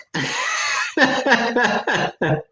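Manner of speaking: a burst of high helpless laughter; real laughing. Real, not acted. Host laughing at his own innuendo to viewers, high giggles. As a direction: crack up at your own joke. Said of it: "I'm sorry I'm laughing".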